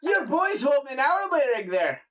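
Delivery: high-pitched, nosy Scottish countryman; Scottish farmer voice pitched well up. Acted. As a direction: call out as a surprised Scottish farmer. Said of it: "like a Scottish person or whatever".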